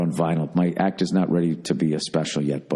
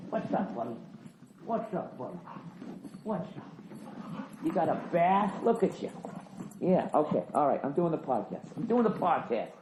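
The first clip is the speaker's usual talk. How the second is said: much softer, higher cooing; quiet cooing pet voice; hushed dog talk; 7 semitones higher, 10 dB quieter than usual. Real, not acted. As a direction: murmur to the dog up close. Cuddling the dog up close.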